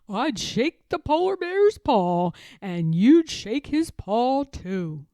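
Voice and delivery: put-on amused old bear; laughing grandfather. A narrator's reading. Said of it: "Grandfather laughed".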